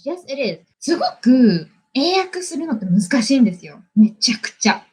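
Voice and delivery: normal talking voice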